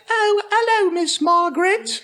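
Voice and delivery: hammed-up British accent; mock British accent. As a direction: parody a posh British period drama. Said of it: "the British accents that I don't care for".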